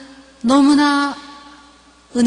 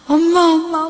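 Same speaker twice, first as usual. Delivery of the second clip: crying daughter; 6 semitones higher, 4 dB louder than usual. Retold or imitated. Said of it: "울어요".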